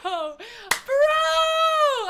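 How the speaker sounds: laughing shout, very high; half-laughing, half-yelling in mock outrage; retold exasperation. Laughing as she retells yelling at the waiter, high shout. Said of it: "I was so mad".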